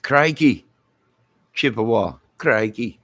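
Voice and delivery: put-on Australian accent crikey; mock Australian crikey. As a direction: exclaim like a croc hunter. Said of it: "Australian banter".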